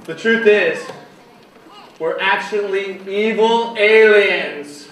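slow dramatic reveal. Pretend.

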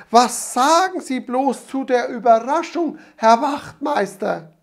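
put-on high, fluttering old-lady voice, nearly overwhelmed; overjoyed grandmother. A narrator's reading. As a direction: grandmother torn between laughing and weeping. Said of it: "Sie lachte und weinte gleichzeitig".

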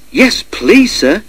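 very high, delighted acceptance; eager. A narrator's reading. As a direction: jump at the offer eagerly. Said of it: "he said eagerly".

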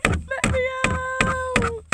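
weak trapped cry for help. Pretend. Trapped, first take.

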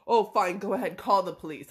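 loud, dismissive taunt in mimicry; imitated defiant dare. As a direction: mimic an angry father calling the bluff. Retold dad daring her to call.

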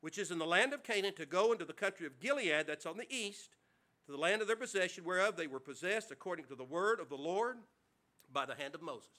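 measured King James reading; scripture reading. Acted. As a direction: read the verses with reverence. Reads scripture aloud to congregation.

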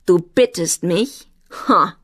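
loud, scornful taunt; mock the plea, then shout contempt; called out. A narrator's reading. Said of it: "rief Saffe".

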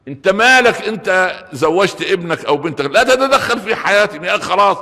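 put-on a grown child telling parents to butt out; hammed-up indignant retort; defiant retort. Voicing a defiant married child.

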